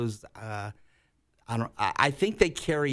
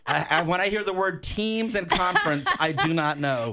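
laughing; 4 semitones higher, 3 dB louder than usual. Real, not acted. Laughing at a hard question.

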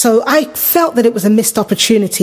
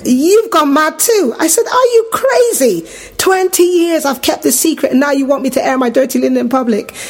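incredulous disbelief; 7 semitones higher than usual. Retold or imitated. Acting her own shocked reply.

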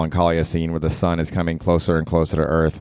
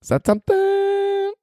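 squeaky high voice; slower than usual. Pretend. Squeaky repeated question.

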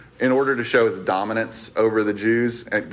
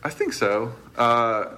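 ordinary talk

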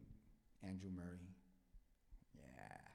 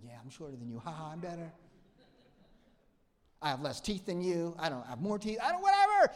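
mock self-boast; 5 semitones higher, 9 dB louder, faster than usual. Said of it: "that's me".

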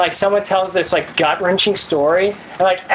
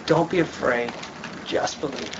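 very soft, low and slowing; hushed reassurance; 8 semitones lower, 8 dB quieter than usual. A narrator's reading. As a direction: let the reassurance sink almost to nothing. Jesus' words read out gently.

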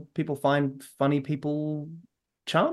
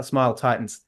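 ordinary talk

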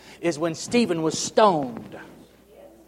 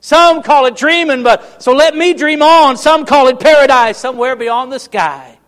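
loud, high, slow sung-out lyric; building recital; 6 semitones higher, 8 dB louder than usual. Shown as a demonstration. Preacher reciting song lyrics to the congregation, rising loud. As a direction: swell the lines up to a climax. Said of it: "read the words to you".

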